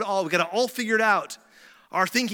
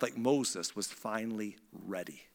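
near-whisper for emphasis; very quiet, low landing; hushed; 8 semitones lower, 10 dB quieter than usual. Real, not acted. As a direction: drop to a soft, breath-only reveal. Hushed climax of the point.

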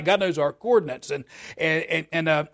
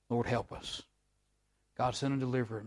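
much softer, hushed plea to God; whispered prayer; 4 semitones lower, 11 dB quieter than usual. Retold or imitated. Beaten slaves pleading to God, in a soft hush. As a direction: breathe the prayer out barely voiced. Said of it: "they just whispered a prayer".

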